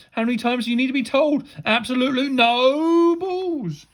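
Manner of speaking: high, loud teacherly outrage; exasperated scolding. A narrator's reading. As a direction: build from quick rant to long loud ban.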